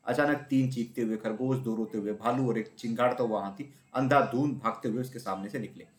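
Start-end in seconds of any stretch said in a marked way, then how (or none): none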